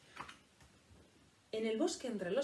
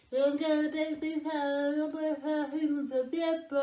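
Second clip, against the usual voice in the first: mouth open mumble; 6 semitones higher, 7 dB louder, much slower than usual. Acted.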